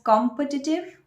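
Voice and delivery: COMpetitive with stress on the first syllable, KOM-puh-ti-tiv; wrong pronunciation. Shown as a demonstration. Teacher showing students the wrong way, com-PEH-ti-tive mispronounced as KOM-pə-ti-tiv. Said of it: "that's a wrong pronunciation".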